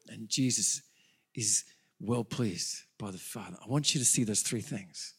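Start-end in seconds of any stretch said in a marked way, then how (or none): 0.1-3.6 hushed whisper. Real, not acted. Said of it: "sudden whisper after loud run".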